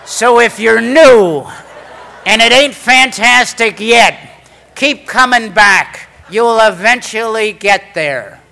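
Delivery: loud, high, slow proclamation; emphatic, drawn-out shout of encouragement; rallying call. Real, not acted. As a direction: belt it out to the whole hall. Closing rallying call to newcomers.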